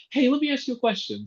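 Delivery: breezy friendly ask, fast and high; polite ask. Retold or imitated. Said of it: "Like, not like".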